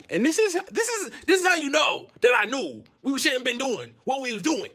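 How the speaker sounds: high-pitched, insistent explaining; worked-up protest. Retold or imitated. Storyteller explains they shouldn't be doing this, high worked-up protest. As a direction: plead your case in a high voice.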